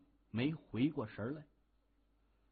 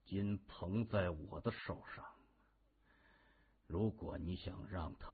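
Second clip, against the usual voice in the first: low, quiet menace; through gritted teeth; 6 semitones lower, 8 dB quieter than usual. A narrator's reading. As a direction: threaten softly with clenched teeth. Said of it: "他咬牙切齿地说". (咬牙切齿地低声威胁)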